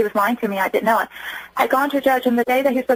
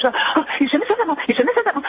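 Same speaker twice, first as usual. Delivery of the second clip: put-on retelling her teenage daughter's frightened outburst, pitched higher; a daughter pleading in alarm, higher voice; panicked daughter; 7 semitones higher than usual. Mother imitating her panicked daughter, higher and frantic. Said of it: "she just freaked out".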